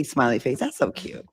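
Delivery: ordinary talk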